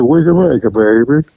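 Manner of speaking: mushy, mouth-full imitation of Charles; mumbled Charles voice. Officer fakes Charles's mumble to fool the lookout. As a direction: mumble like your mouth is stuffed. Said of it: "Get that shit out of your mouth and talk right".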